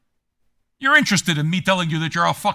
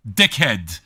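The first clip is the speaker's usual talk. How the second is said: normal talking voice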